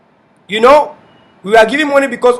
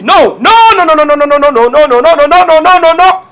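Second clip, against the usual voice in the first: emphatic denial. Real, not acted. Vehement denial, repeated no's.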